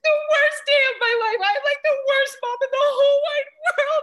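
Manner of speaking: very high, loud, tearful shrieking rant; hammed-up screaming. Mom having a meltdown at the secretary, screaming and bawling. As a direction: wail it like a hysterical meltdown, played for laughs. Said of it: "I'm screaming back at her".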